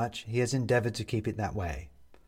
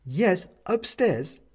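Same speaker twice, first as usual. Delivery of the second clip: high, slow, concerned woman's voice; worried neighbour woman; 9 semitones higher, 4 dB louder, much slower than usual. A narrator's reading. Upstairs neighbour finding him on the floor, worried.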